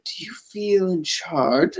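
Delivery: a hint of Bane, slow and quiet; menacing Bane. Voicing Bane's menacing line.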